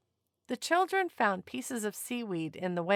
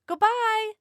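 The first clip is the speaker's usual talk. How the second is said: much higher, louder farewell call; shouted goodbye; 13 semitones higher, 6 dB louder than usual. A narrator's reading. People on the beach farewelling the whales, loud cheerful shout. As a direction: wave them off with a big call. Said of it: "they shouted".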